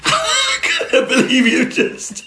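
high, slow laughing words. Real, not acted. Laughing at her reaction.

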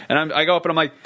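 ordinary talk, nothing marked

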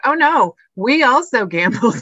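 high, loud comic "oh no"; mock concern. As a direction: pipe up in pretend dismay. Mock reply to gambling advice, joking.

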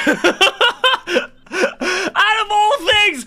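high, loud words through laughter; laughing hard. Real, not acted. Host breaking up over his embarrassing dream, laughing loudly. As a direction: crack up mid-sentence.

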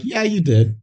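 high, mock blunt agreement; lightly voiced, deadpan sidekick; Ron agreeing. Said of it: "And Ron's like".

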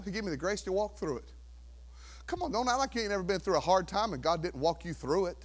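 ordinary talk

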